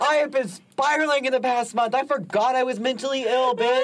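high-pitched, worked-up comic outburst; exclaimed realization. Real, not acted. Guest confessing she forgot her illness, high incredulous outburst. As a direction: cry it out, incredulous at yourself.

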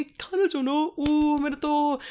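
high, cooing child imitation; teasing kids' voice. Third graders congratulating teacher on girlfriend, high teasing coo.

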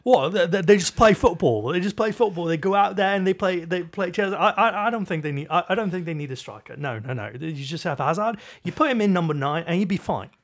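hammed-up impression of a bumbling English pundit; a clumsy English football pundit accent, played for laughs; bad Paul Merson impression. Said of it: "That was the world's worst Paul Merson impression".

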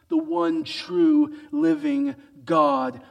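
ordinary talk, nothing marked

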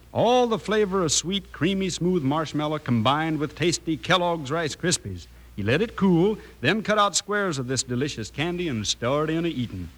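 normal talking voice

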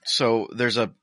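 usual speaking voice

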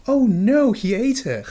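a sudden high loud cry of dismay; mock panic. Author discovering his dog ate the draft, in mock panic. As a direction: yelp it like you just caught the dog eating your pages. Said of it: "go like".

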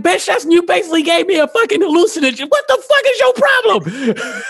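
hammed-up retold scolding, pitch climbing sharply; exasperated outrage. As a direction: build to a squealed, incredulous rant at the end. Retold quarrel with his brother.

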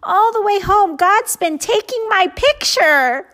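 beam with pride at the lightning; enthusiastic little girl. Acted. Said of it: "explaining enthusiastically".

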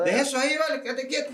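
mock Chilean scolding.

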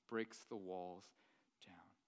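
breathy whispered emphasis; hushed sermon whisper. Acted. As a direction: drop to a whisper for the point. Sermon point dropped to whisper.

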